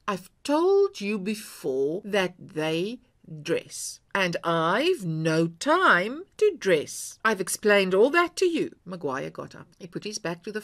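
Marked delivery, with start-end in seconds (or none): 0.1-8.8 slow, deliberate irritated wife; terse irritation. A narrator's reading. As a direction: spell it out slowly, irritated.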